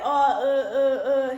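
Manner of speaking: imitating a nervous stammer; lightly voiced caught-out stutter; mock stutter. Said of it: "He gets his fucking stuttering".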